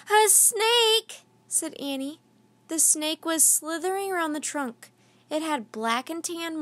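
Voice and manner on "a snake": high, loud gasp of alarm; startled cry. A narrator's reading. Annie spotting the snake below, high startled cry. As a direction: cry out in sudden fear.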